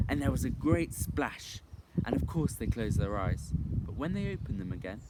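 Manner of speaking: plain reading voice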